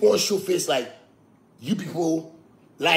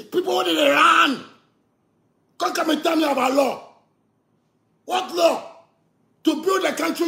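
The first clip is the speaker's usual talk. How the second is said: fired-up preaching rant; heated rant; 8 semitones higher than usual. Real, not acted. Calling on Ghana to wake up, fired-up rant. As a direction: push every line out, raised and urgent.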